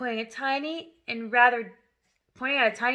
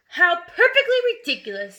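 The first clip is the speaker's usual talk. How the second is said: louder, higher contemptuous snort of words; snorting scorn; 6 semitones higher, 6 dB louder than usual. Acted. Headmistress scoffing at the spelling song, loud and contemptuous. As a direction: scoff at it with disgust. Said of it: "snorted the Trunchbull".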